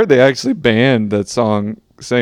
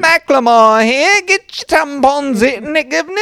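put-on awful British accent; 12 semitones higher than usual. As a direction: play a terrible Brit street seller, squeaky high. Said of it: "awful British accent".

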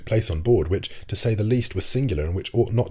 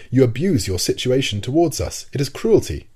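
louder and higher, indignant accusation; growing anger; 5 semitones higher, 5 dB louder than usual. A narrator's reading. Professor accuses the captain of cruelty, angry and louder. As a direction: accuse him, anger slipping out. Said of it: "beginning to get angry in spite of myself".

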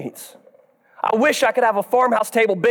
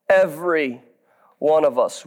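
usual speaking voice

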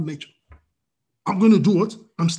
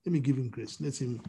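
softer, lower voice of God's grace; God's grace gently speaking up for someone; 7 semitones lower, 11 dB quieter, faster than usual. Retold or imitated. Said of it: "The grace of God comes and says".

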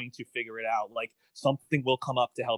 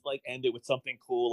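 ordinary talk, nothing marked

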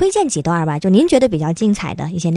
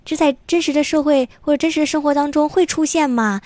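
put-on amazed child; 8 semitones higher than usual. Imagined child wondering aloud.

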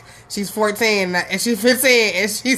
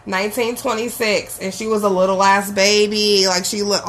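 ordinary talk, nothing marked

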